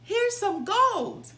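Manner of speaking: high, loud and slow shout. Acted. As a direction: call it out slowly so everyone wakes. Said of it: "he shouted".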